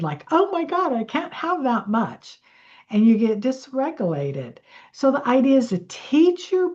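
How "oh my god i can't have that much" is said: high, mildly panicked gasp; mock panic. Nervous system facing more money, mock panic. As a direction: play the body's panic, pitched up high. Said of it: "it goes into panic mode".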